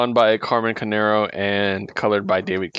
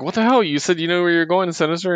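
higher-pitched mock indignation; indignant retort; 7 semitones higher, faster than usual. Team confronting Sinister over the route, mock indignant. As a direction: demand an explanation, pitched up.

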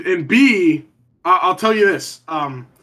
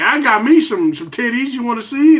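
higher hammed-up southern offer; 5 semitones higher, 3 dB louder than usual. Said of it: "and was like".

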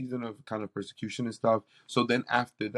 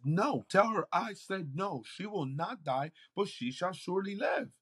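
Jesus speaking; 8 semitones higher than usual. Retold or imitated. Jesus sending word to the sick mother, solemn and higher. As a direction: pronounce it like a firm promise. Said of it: "He says".

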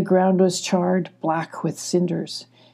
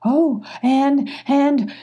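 higher, eager stammered start; excited, hopeful; 7 semitones higher, slower than usual. A narrator's reading. Emmy spots hope at the ridge, excited and high. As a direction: burst in with rising hope. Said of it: "said excitedly, full of hope".

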